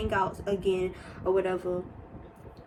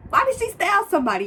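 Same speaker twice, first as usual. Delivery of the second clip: much higher, louder and faster; outraged disbelief; 10 semitones higher, 6 dB louder, faster than usual. Retold or imitated. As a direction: squeal the question in disbelief. Repeats it more shocked.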